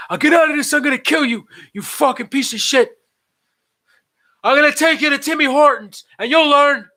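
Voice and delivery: high, loud strained yelling; hammed-up screaming vow of revenge; furious mock threat. Bound [name] threatens revenge.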